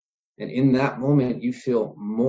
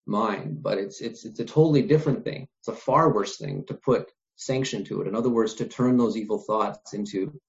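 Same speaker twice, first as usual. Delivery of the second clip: normal talking voice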